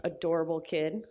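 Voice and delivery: ordinary talk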